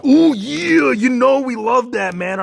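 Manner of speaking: channel a wrestling legend's catchphrase; hyped wrestler promo voice; Macho Man voice. Retold or imitated. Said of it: "Let's ask the Macho Man".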